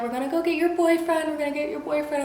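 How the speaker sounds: high, lilting nurse imitation; soothing nurses. Nurses telling loopy patient about boyfriend, soothing. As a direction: coo it to a groggy patient.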